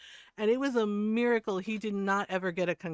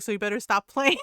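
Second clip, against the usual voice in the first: louder mock-stern warning; lightly voiced scolding mother; scolding mom; 2 semitones higher, 8 dB louder, faster than usual. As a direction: imitate mom cutting off the horseplay. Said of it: "She's like".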